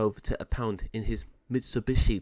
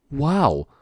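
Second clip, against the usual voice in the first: impressed dog; 6 semitones higher, 3 dB louder, slower than usual. A narrator's reading. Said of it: "Caged dog one says".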